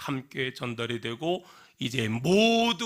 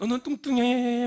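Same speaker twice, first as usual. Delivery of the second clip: higher, louder mock echo; echoed insult; 11 semitones higher, 6 dB louder than usual. Mountain echoing the girl's insult back, loud. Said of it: "막 이러고 있는 거야".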